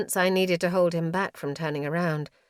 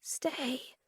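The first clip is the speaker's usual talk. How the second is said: voiceless whisper; 6 semitones higher, 9 dB quieter than usual. A narrator's reading. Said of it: "voiceless whisper".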